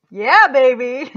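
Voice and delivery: loud, drawn-out whoop of triumph; celebratory cheer. Real, not acted. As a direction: let it out big and slow, about to laugh. Celebrates finishing the positive round.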